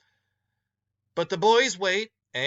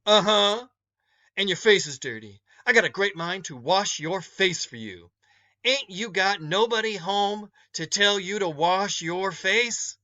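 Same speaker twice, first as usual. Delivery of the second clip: louder, higher, scolding matron; a no-nonsense woman chiding a dirty-faced boy; scolding older woman; 4 semitones higher, 3 dB louder than usual. Acted. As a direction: tell him off like a stern mother figure.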